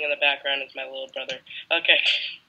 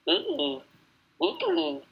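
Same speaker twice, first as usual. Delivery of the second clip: dying donkey voice; 14 semitones higher, 6 dB quieter than usual. Acted. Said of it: "my dying donkey voice".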